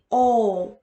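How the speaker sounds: slow, held 어 midway to 오; 어 lengthened, shaded toward 오; between 어 and 오. Shown as a demonstration. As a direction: stretch 어 with a sound between 어 and 오. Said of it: "어와 오의 중간 발음이".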